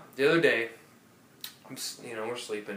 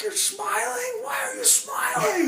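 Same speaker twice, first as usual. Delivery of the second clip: very high, slow falsetto yell, cracking into laughter; mock outraged shout. Neighbor raging at smiling girlfriend, high mock shout. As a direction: scream it in disbelief, hammed up. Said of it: "He's like".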